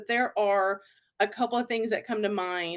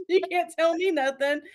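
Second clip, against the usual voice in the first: laughing defiance; 7 semitones higher than usual. Retold or imitated.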